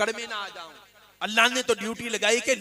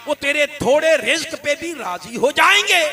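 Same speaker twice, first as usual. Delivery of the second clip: higher, louder building sermon cry; fervent preaching; 6 semitones higher, 6 dB louder than usual. Acted. Preacher recounting Moses's plea, fervent. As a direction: lift the crowd with each phrase.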